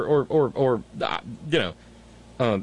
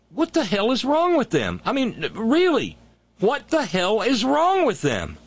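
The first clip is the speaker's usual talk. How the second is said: exasperated outrage; 8 semitones higher than usual. Real, not acted. Host ranting about the unvaccinated, exasperated outrage. Exasperated rant at vaccine refusers.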